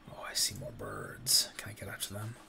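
hushed whisper, sneaking. Real, not acted. Creeping up on the birds, a soft whisper. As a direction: whisper so the birds won't hear.

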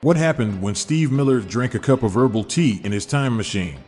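normal talking voice

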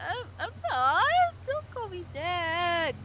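flustered, drawn-out bashful protest. Acted. Showing own reaction to being called cute, bashful. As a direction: stammer shyly, stretching the last words. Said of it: "bashful mode".

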